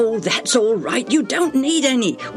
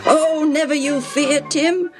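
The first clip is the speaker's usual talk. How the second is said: high, confident cheering-up; hearty reassurance; 10 semitones higher than usual. A narrator's reading. Reuben answering frightened Tim, heartily reassuring. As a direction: brush the fear away brightly.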